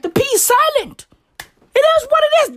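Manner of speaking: high squeaky mock tone. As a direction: squeak it out playfully. Squeaky joking remark.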